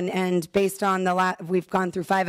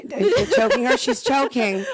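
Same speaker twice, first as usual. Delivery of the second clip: laughing; 5 semitones higher, 3 dB louder than usual. Real, not acted. Joking as colleague coughs nearby.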